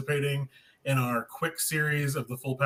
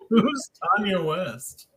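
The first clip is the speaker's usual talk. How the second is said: laughing, slow teasing quote; laughing imitation of the mistake; mock repeat, laughing; 5 semitones higher, 4 dB louder, slower than usual. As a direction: tease her by repeating the misheard name. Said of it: "teasing repeat of the misheard name".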